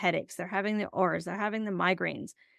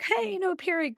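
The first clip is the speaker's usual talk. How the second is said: high, cheery mock delight; 12 semitones higher than usual. Imitating her happy past self about the IUD, high and cheery. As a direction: sound thrilled, pitched way up.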